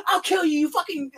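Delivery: acted-out furious yell, cut short; mock angry threat. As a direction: imitate someone going off on a coworker. Retold threat from angry coworkers.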